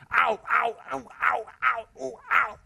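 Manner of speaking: high, loud, hammed-up ows of sore feet; a big guy's mock cries of pain, high and loud; mock pained yelps. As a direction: yelp like a big man hobbling in pumps. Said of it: "What a butch voice, strong voice".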